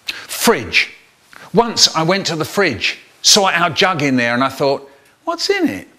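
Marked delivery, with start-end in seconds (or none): 5.3-5.9 high, quick wondering question; curious. Acted. Boy peering into the fridge jug, curious. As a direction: wonder aloud what's inside.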